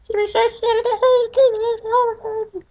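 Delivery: high, slow, wailing mock of a frightened little girl; mock scared girl. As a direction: stretch every word in a whimpering high voice. Mocking scared cousin.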